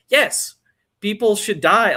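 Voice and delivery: high, loud sarcastic yes, people should die; mock agreement. Mocking the film's message to his co-host, high sarcastic agreement. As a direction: voice the film's stance with scorn.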